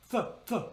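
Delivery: "Th, th" breathed with no voice; voiceless TH hiss; unvoiced TH. Shown as a demonstration. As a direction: blow air past the tongue. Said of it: "'cause there's no pitch given to it".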